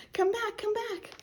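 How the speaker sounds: high mock-urgent call; high, pretend-worried plea; playful calling back. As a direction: call after little ones in play. Calls flying children back in play.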